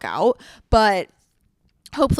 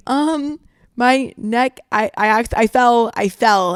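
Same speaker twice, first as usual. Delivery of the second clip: retold halting cover story, pitched up; fumbling excuse; 3 semitones higher, 6 dB louder, slower than usual. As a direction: stumble and restart like a bad improvised alibi. Acted stumbling cover story to Bear.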